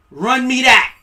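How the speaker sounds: loud, high demand. Acted. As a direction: hand it over now. Repeated demand, second louder.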